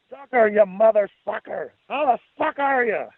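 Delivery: put-on high, rowdy drunk friend bellowing a hello; sloppy drunken welcome, pitched high; rowdy drunk greeting. Jesus voiced greeting loudly.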